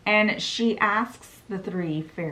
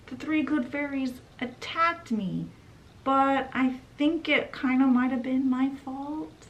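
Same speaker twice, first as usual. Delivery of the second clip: put-on little girl voice; 6 semitones higher than usual. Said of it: "through her tears".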